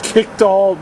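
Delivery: high, worked-up swearing rant; real overwrought, exasperated outburst; too upset to talk. Real, not acted. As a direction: spit out the frustration till words fail. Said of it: "I can't even talk right now".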